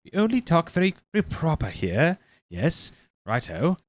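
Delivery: high, slow, prissy put-on British accent; mock posh British. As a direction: ham up a fake proper British accent. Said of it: "you're being like".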